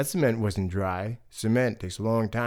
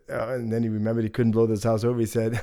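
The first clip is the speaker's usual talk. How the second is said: ordinary delivery, nothing marked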